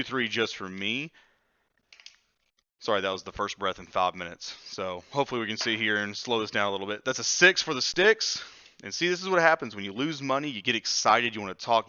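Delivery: ordinary delivery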